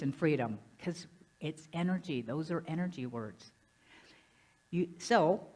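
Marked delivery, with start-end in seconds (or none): none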